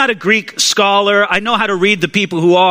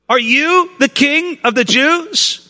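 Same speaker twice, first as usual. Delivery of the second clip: slow, higher-pitched, mocking question; Pilate, sarcastic; 6 semitones higher, slower than usual. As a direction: draw out the doubt, rising at the end. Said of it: "maybe even a bit sarcastically".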